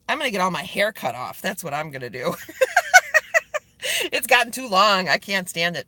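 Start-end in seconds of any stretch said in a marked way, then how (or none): 2.4-3.6 much higher, louder giggle; tickled by own joke; high burst of laughter. Real, not acted. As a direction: laugh at your own silly idea. Laughs at own haircut plan.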